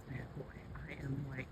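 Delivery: hushed so it won't hear; barely breathed words; whisper. Real, not acted. Creeping up on an animal.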